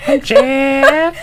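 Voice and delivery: high, laughing fake Spanish accent; giggly, awkward Spanish accent; mock Spanish accent. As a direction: imitate a bad Spanish accent through laughter. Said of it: "You got your accent down".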